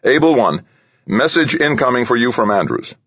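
high-pitched radio call from the tower; flight controller call. A narrator's reading. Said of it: "the flight controller called".

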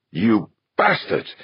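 high, slow outraged curse; furious. A narrator's reading. Westfall cursing the two men, furious. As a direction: spit out each word in outrage.

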